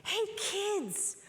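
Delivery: put-on hushed, high conspiratorial hey kids; hushed teacher whisper to the kids; mock whisper. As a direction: lean in and whisper it like a secret. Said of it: "she bent down and she said".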